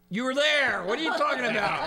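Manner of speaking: exasperated shout. Retold or imitated. Depot quoted, confused.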